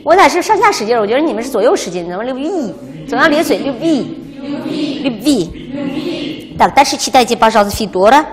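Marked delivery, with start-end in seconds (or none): 2.1-6.6 любви said slowly with lips stretched sideways; lips spread wide. Shown as a demonstration.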